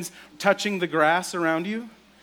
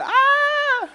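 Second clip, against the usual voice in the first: very high loud mock shriek; kids' scream; 8 dB louder than usual. Shepherd kids screaming again, high mock shriek. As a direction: scream like a terrified shepherd kid. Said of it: "They said".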